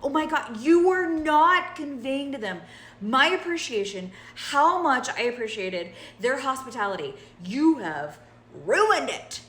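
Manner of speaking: mock-horrified yell at a friend who didn't translate; exasperated outburst. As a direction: blow up at your friend in mock horror. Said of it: "And I was like".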